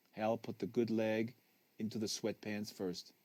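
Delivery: ordinary delivery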